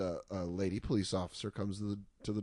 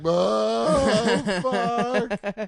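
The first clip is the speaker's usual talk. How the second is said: much higher, louder oh fuck of relief; relieved exclamation; 10 semitones higher, 10 dB louder than usual. Retold or imitated. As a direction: let it burst out in disbelief. Relieved exclaim after cop leaves.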